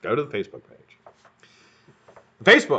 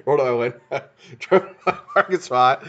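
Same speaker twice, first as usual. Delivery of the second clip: real laughter through the words; giggling while telling it; laughing; 3 semitones higher than usual. Real, not acted. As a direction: still cracking up at the memory. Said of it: "I actually started laughing".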